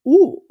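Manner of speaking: sharp gasp and a loud, high ooh; gasp, then let out a startled ooh; shocked gasp then ooh. Retold or imitated. Realising what she did, a loud shocked ooh. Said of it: "And I was like".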